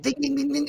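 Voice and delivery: high, soft ding ding chime; imitated rev limiter. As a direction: copy the limiter pinging at night. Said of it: "rev limiters when you're sleeping".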